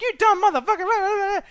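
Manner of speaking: very high, drawn-out imitation of a tirade; hammed-up squealed insult; mock furious screech. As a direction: mimic someone blowing up, pitch way up. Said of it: "I was, I was scared".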